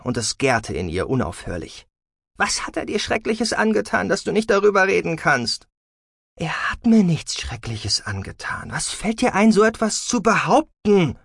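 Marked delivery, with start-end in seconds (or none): 2.4-5.7 high, heated demand for an answer; agitated, accusing question; growing anger. A narrator's reading. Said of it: "Sahira wurde immer wütender". 6.4-11.2 outraged mother, climbing high and louder; affronted rebuke that builds in pitch; indignant. A narrator's reading. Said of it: "empörte sich Siela".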